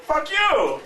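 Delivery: high, retold angry retort; indignant outburst. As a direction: imitate an outraged refusal, pitch leaping up.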